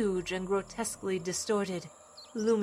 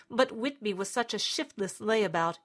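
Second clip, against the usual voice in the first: ordinary delivery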